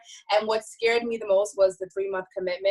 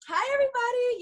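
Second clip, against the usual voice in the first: a much higher, cheerful hi to everyone; bright hi. Real, not acted. Guest greeting the host's team, cheery. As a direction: wave hello in your voice.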